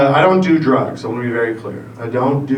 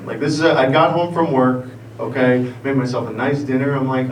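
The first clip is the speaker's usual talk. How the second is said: usual speaking voice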